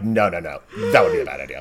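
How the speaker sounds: a hint of a deep dismissive elder; mock calm refusal. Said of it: "they're just straight up like".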